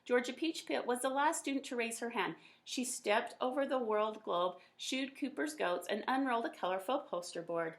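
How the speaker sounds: usual speaking voice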